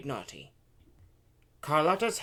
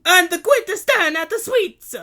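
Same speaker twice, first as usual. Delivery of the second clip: very high, scolding shout; 16 dB louder than usual. A narrator's reading. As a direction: shout right at the child. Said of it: "pointed her finger at the girl's face and shouted".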